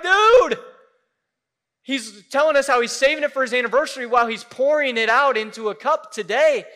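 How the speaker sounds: high, loud disbelieving cry of dude; shocked exclamation. Retold or imitated. Pastor retells the congregation's shock, loud and high.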